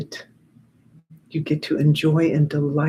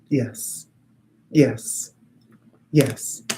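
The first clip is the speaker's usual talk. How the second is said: guided meditation; 3 semitones lower, slower than usual. Acted. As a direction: send it out gently to the group.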